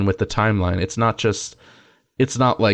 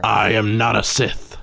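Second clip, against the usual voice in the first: mock villain voice; slower than usual. Joining the mock Anakin voice.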